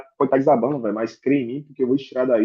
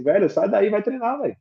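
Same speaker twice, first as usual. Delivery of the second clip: imitating mom; 5 semitones higher than usual. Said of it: "minha mãe ainda falou assim".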